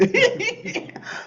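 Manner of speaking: bright high burst of laughter; real laughter. Real, not acted. Recalling Alan's support, bursts into high laughter. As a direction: crack up at the memory. Laughing after quoting Alan.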